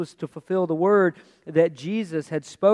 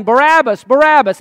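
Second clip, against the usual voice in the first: full-force, high mob shout; crowd yelling; 8 semitones higher, 14 dB louder than usual. Acted. What the prisoner hears from his cell, crowd yelling. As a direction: bellow the name as a whole crowd would. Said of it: "is the crowd yelling".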